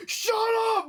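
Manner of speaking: high, loud mock-angry outburst; imitated mad yell. Wrestling heel silencing a cheering crowd, imitated mad yell. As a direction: yell it like a heckled wrestler, half laughing. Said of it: "he was, like, he was mad".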